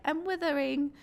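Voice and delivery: high, wilting British drama-queen lament; dramatic British accent. Pretend. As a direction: moan it like a wilting English period-drama heroine. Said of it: "I switched into a British accent".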